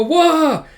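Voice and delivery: loud, high "wah" yell; short high-pitched comic-book wail; acted battle cry.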